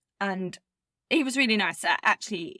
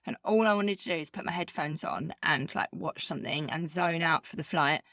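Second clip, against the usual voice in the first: ordinary talk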